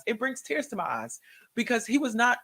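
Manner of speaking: usual speaking voice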